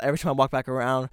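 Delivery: ordinary delivery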